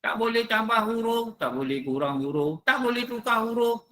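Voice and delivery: each tak boleh stressed in turn; rhythmic list of tambah kurang tukar; listing the rules. Shown as a demonstration. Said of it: "ada tiga syarat".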